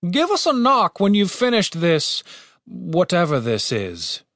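high-pitched order that drops off; a command trailing into slow puzzlement. A narrator's reading. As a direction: give an order, then slow down in bafflement. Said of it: "he commanded".

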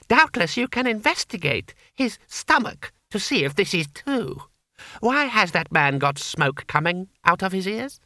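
put-on high, silky-polite child ruler; high-pitched imperial mockery; menacing boy Emperor. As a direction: threaten through courtly politeness.

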